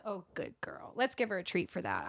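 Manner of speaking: hushed whisper praising a pet; whispering to dog. Real, not acted.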